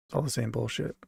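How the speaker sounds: ordinary delivery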